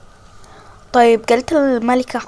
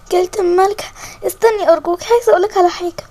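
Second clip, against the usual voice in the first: desperate plea; 6 semitones higher, faster than usual. Acted. Girl chases queen, pleading.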